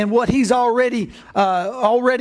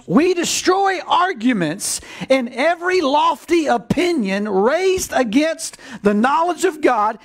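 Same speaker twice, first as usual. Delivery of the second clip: fiery preaching; 8 semitones higher than usual. Acted. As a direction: preach it out over the congregation. Preaching at full force.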